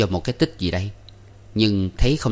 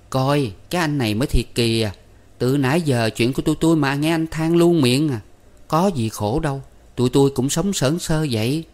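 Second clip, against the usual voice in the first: put-on higher, animated young woman; Con Ba, brushing off pity; 6 semitones higher than usual. A narrator's reading. Ba tells the guest not to pity them, protesting. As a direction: protest lightly with a raised pitch.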